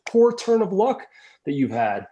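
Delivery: high, slow mock-sympathetic villager voice; exclaiming villagers. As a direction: lament his bad luck in a put-on voice. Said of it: "they exclaim".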